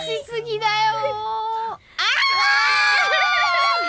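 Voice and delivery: high-pitched shriek of horror. Real, not acted. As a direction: squeal in horror at the screen. Reacting to a painful scene.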